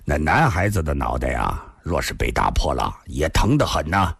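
low-voiced man with a short chuckle first; chuckle, then answer playfully in a low voice; good-humoured low male character; amused chuckling man. A narrator's reading. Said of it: "李寻欢也笑了".

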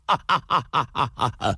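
long staccato belly laugh, trailing away; acted guffaw in rapid bursts; hearty laugh. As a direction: let a big theatrical laugh roll out. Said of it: "突然大笑地说道".

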